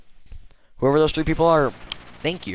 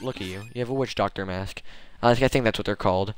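ordinary delivery

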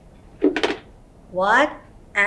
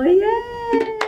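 high cheerful yay; cheering; 13 semitones higher than usual. Acted. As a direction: cheer for the kids. Cheering after song.

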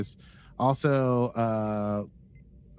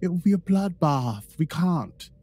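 high, emphatic mock British accent; 8 semitones higher, 4 dB louder, slower than usual. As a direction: insist in a put-on British accent. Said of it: "because of the British accent".

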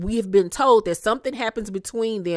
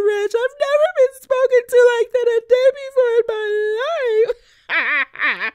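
much higher, loud, whiny mock crying voice; mock sobbing Lisa; 6 dB louder than usual. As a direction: ham up a teary, hysterical phone call to the friend's husband. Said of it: "And she's like".

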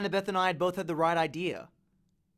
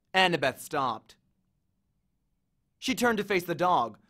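usual speaking voice

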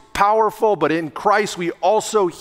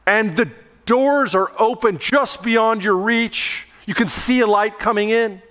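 usual speaking voice

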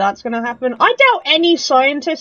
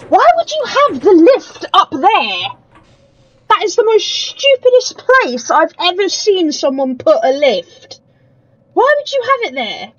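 higher, louder exasperated rant; 6 semitones higher, 4 dB louder than usual. Real, not acted. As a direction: vent at the silly design. Ranting at the lift placement.

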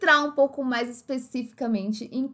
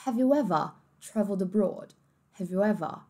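British English, "ever" ending in a soft a; British accent; 5 dB quieter than usual. Shown as a demonstration. Teacher drilling British r-less ending for students. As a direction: drop the r, "ever" as "eva". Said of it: "no inglês mais britânico".

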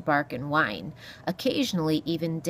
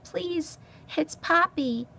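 high little mouse voice; timid mouse; 12 semitones higher, slower than usual. Acted. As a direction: explain politely in a high mouse voice. Mouse meekly corrects her name.

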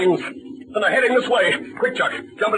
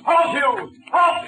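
higher shout to halt; shouting a final warning at the fleeing man; shouted command; 6 semitones higher, much slower than usual. Acted. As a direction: yell the order at him. Ordering fleeing man to stop.

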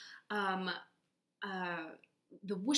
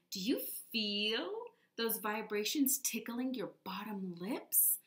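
ordinary talk

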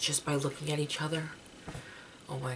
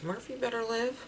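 mock warning; 8 semitones higher than usual. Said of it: "I was like".

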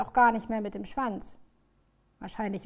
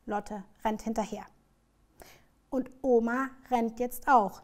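ordinary delivery

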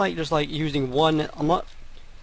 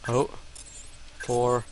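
normal talking voice

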